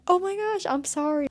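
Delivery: high, loud burst of disbelief; exasperated outburst. Real, not acted. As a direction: blurt it out, scandalized. Outraged at Harry attacking her.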